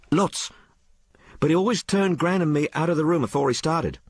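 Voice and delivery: put-on high-pitched, colloquial working girl; working-class maid Sal. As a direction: tell it as an uneducated maid confiding in her mistress. Story figure Sal.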